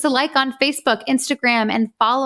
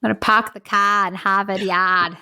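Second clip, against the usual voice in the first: playful Boston accent impression. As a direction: drop the r's, thick Boston accent. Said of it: "It's my best Boston accent".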